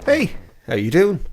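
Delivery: ordinary talk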